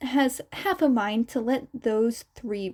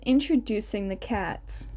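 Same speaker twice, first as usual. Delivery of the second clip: plain reading voice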